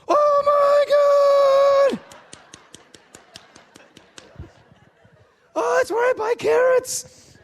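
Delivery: very high, loud, drawn-out shriek; ecstatic shriek. Retold or imitated. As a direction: shriek with delight. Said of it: "Jerry O'Connell".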